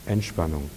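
ENTSPANNUNG low, soft and falling; relaxation. Shown as a demonstration. Preacher shows congregation release after tension, ENTSPANNUNG soft, low and relaxed. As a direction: let go on ENTSPANNUNG, sinking softly. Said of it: "Merkt ihr das?".

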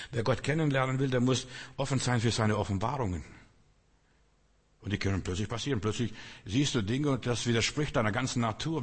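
ordinary talk

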